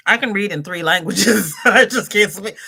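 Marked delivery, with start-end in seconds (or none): 1.6-2.6 laughing. Real, not acted. Joke about reading three languages.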